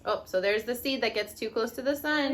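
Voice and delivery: normal talking voice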